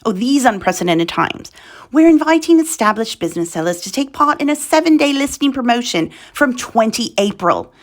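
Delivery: put-on royal British accent; the Queen. Host reading eBay announcement to UK sellers, mock British Queen accent. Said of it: "I want us to sound like the Queen".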